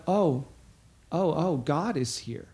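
soft, slow hushed awe; awed realization. Retold or imitated. Believer realizing God is present, hushed awe. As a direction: let it dawn on you quietly. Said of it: "that realization of".

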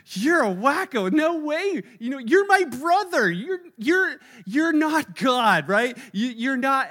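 high, loud scoffing; mocking siblings. Younger brother dismissing his older brother, scoffing. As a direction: scoff at him like a kid brother.

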